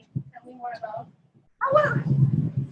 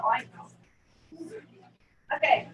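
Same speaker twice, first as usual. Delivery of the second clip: normal talking voice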